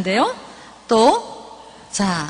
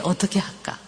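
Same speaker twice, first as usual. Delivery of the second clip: lower, softer, tender coaxing; soft motherly voice, lower and hushed; gentle coaxing mother; 4 semitones lower, 6 dB quieter than usual. Shown as a demonstration. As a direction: coax a sick child to open up. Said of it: "이게 뭐냐, 걔한테".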